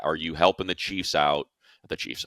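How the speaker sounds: normal talking voice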